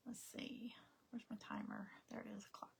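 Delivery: whispering to herself while searching; quiet search-muttering, half turned away; whispered aside. Real, not acted. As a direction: breathe the words, barely voiced. Searching for timer app.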